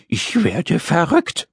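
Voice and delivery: slow, high groan of a little man; exasperated groan. Acted. Little man cannot bear waiting, exasperated groan. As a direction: groan it out, at wit's end. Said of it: "stöhnte der kleine rosa Mann".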